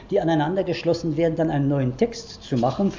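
ordinary talk, nothing marked

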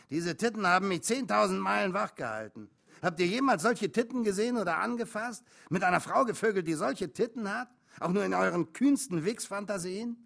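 high, loud trucker enthusiasm; worked-up, rapturous bragging; excited boasting. A narrator's reading. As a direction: marvel insistently, demanding agreement. Trucker boasts about pictures.

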